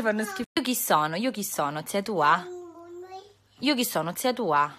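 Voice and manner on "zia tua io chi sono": normal talking voice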